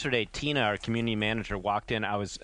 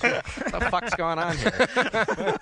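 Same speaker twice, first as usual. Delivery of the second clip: slow, higher, laughing bewildered question; laughing disbelief; 8 semitones higher, 3 dB louder, much slower than usual. Retold or imitated. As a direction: replay the bewildered moment while cracking up. Retelling his bewildered reaction.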